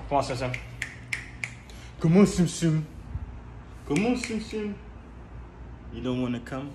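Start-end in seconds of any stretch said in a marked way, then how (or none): 2.0-2.9 higher coaxing call. Real, not acted. Calling the cat out. 3.9-4.7 much higher, sing-song call to the cat; high coaxing call. Real, not acted. Owner coaxing his cat out of the carrier, high sing-song call.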